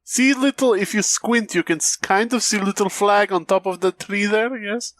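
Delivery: high hammed Russian accent pointing something out; mock Russian accent. Joining the Russian bit.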